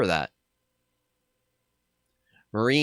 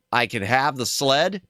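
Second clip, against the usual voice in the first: ordinary talk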